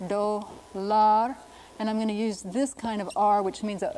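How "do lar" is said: do, lar in two drawn-out syllables, do stressed; syllable-by-syllable Spanish. Shown as a demonstration. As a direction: sound out do, lar with Spanish vowels. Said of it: "First syllable accented here".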